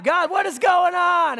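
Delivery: high, loud exasperated cry; acted anguished appeal to heaven; crying out to God. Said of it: "but you still cry out".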